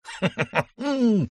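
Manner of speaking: high theatrical laugh; a showman's booming laugh; baron's laugh. A narrator's reading. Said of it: "рассмеялся барон Брюс Мусин".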